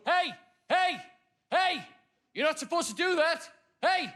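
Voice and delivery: high-pitched telling-off; sharp, high mock scolding; imitated shouting. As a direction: mimic an indignant lollipop lady calling out. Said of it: "she's shouting at us".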